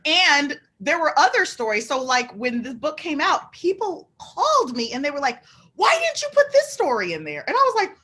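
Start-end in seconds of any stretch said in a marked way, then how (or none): 5.8-7.4 high-pitched, exclaimed complaint; indignant caller. Retold or imitated. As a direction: imitate callers demanding an explanation.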